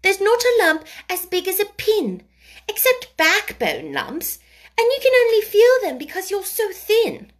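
put-on high, loud, emphatic childish scolding; cross child. A narrator's reading. Mary tells the boy there's no lump, crossly. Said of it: "those crossly spoken childish words".

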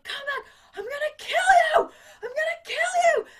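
high, loud roaring threat; murderous yelling. Retold or imitated. Villain chasing the girl up the bridge, yelling high and loud. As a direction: bellow it while chasing her. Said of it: "And Tim Curry's like".

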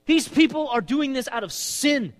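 high and loud, slowing at the end; impassioned plea. Acted. David accusing his enemies before God, loud. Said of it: "And he says".

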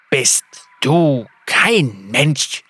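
slow, lisping question; lisping reptile voice. A narrator's reading. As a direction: hiss it out slowly. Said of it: "seltsamen Lispeln".

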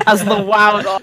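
high, laughing repeat of the misread; mocking the misreading. Reader mocking her own misreading, high and laughing. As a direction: echo the slip while laughing. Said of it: "I was like".

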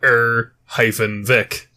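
"Er hyphen Vic" slowly, piece by piece; phonetic spelling-out. Shown as a demonstration. Guest reads out the immigrant record, slow "Er hyphen Vic". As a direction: separate "Er", "hyphen" and "Vic" with small gaps. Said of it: "write out your phonetic pronunciation".

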